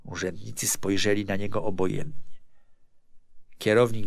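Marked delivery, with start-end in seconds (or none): none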